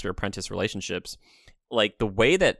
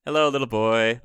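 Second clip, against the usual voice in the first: put-on gentle old man greeting; 4 dB louder than usual. Shown as a demonstration. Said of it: "kind of like".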